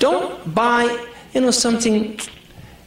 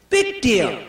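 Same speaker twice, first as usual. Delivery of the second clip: mocking shrug; 12 semitones higher than usual. Husband's view, mocking.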